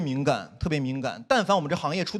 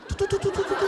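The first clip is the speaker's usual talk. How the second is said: high rapid-fire mock machine gun rattle; playful high-pitched gunfire imitation; machine gun noise; 14 semitones higher than usual. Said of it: "有点像被那个机关枪扫过了一样".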